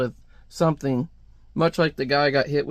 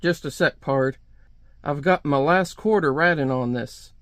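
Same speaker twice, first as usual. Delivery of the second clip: plain reading voice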